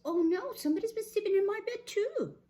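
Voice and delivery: high, fast, alarmed bear; Mama Bear. Acted. Mama Bear at her bed, high alarm. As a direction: exclaim it high, loud and quick.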